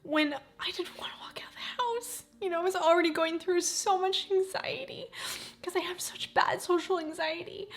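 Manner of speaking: high, teary voice, half whispered; still teary. Real, not acted. Vlogger tells viewers about her social anxiety, still teary. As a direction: still recovering from tears. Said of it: "gets me all emotional".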